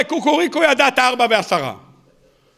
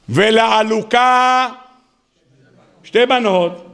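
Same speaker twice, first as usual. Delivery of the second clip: very slow, drawn-out recitation; solemn quoting; much slower than usual. Real, not acted. Rabbi quoting the Talmud to his class, very slow. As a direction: lay out each word slowly like a text.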